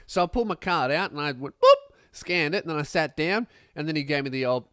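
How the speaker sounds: normal talking voice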